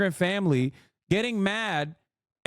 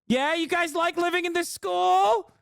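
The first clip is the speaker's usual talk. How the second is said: mocking reporter; 10 semitones higher than usual. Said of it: "it's just".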